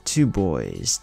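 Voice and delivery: ordinary delivery, nothing marked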